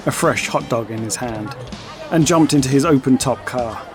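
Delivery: ordinary talk, nothing marked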